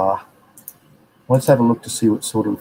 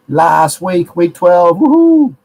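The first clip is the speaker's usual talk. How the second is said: excited cheer; 7 semitones higher, 7 dB louder, much slower than usual. Real, not acted. Host cheering to viewers about the final course week, excited. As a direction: pitch climbing to a high, loud woohoo.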